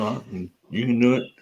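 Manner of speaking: ordinary talk, nothing marked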